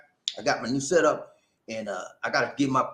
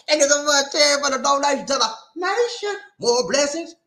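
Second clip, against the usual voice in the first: high, loud celebratory shout-out; excited thanks; 13 semitones higher, 4 dB louder than usual. Real, not acted. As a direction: celebrate the donor with a big high voice.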